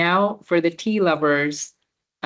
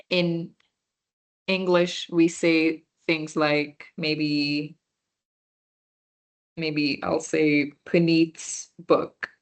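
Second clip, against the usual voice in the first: slow teaching; slower than usual. Shown as a demonstration. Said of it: "which shows possession".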